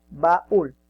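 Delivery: Baúl in two loud beats, ba then úl; syllable by syllable. Shown as a demonstration. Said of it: "está formado por dos sílabas".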